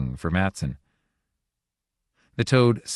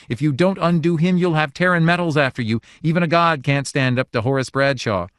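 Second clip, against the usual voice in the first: high, louder angry threats; furious threat; 8 semitones higher, 4 dB louder than usual. A narrator's reading. Wife threatening the little god, angry and high. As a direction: fists clenched, threaten the god. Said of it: "clenching her small fists".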